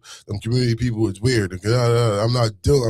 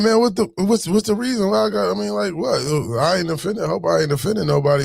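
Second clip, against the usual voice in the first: confused protest; 8 semitones higher than usual. Retold or imitated. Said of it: "I'm like".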